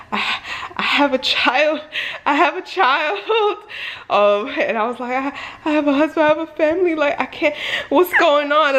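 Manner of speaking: high, frantic pleading, close to tears; panicked plea. Retold or imitated. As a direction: beg like you're scared for your life. Panicked pleading in car, retold.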